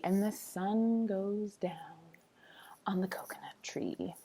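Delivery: soft hushed whisper. Acted. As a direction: whisper like the day is ending. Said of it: "bedtime ending".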